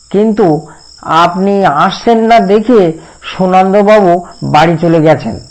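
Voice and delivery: high, loud, nasal servant's whine; nasal whiny voice. A narrator's reading. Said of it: "তিলক খনখনি গলায় উত্তর দিল". (খনখনে নাকি সুরে, উঁচু গলায়)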